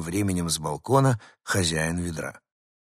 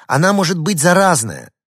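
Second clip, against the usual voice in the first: high, loud warning with stress on "Она"; alarmed shout; 8 semitones higher, 8 dB louder than usual. A narrator's reading. Girl warns the dogs the rat is infected, shouting. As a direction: yell the danger at them. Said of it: "снова крикнула рыженькая".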